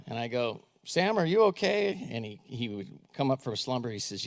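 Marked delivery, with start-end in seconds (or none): 0.8-2.1 higher and slow, checking on him; concerned call. Retold or imitated. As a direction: gently rouse a drowsy teenager by name. Asking a dozing teen.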